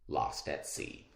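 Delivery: low, slow, hushed title read; breathy hush on lost at sea. Acted. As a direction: let the title hang like a secret. Dramatic reading of story title.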